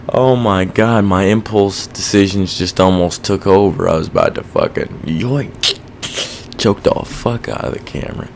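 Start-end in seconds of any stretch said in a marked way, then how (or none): none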